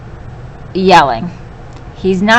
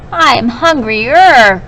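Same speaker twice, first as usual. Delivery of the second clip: slow, louder whiny complaint; teacher shows the whiny hungry goat, slow and louder; whiny and crying; 4 semitones higher, 5 dB louder, slower than usual. Shown as a demonstration. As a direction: drag it out like a hungry whining kid. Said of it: "I sort of made my voice whiny and cryy".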